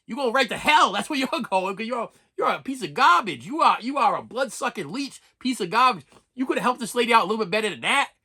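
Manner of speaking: damn the cheat with mock divine outrage; God passing judgement. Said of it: "God just looks at you and goes".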